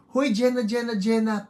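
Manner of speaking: high, loud playful hail; calling out. Real, not acted. Host hailing a long-unseen viewer, calling out loudly. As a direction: call out to get their attention.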